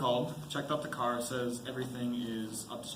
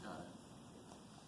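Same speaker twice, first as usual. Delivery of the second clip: barely whispered; 6 semitones lower, 19 dB quieter than usual. Acted. Husband quietly concedes.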